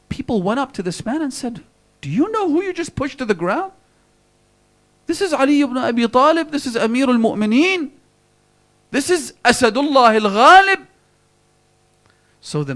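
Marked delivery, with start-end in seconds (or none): 2.0-10.9 high, loud, shocked crowd calling out; crowd outcry. Retold or imitated. People telling the seller who he pushed, in a high, loud outcry. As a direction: tell him who he just pushed.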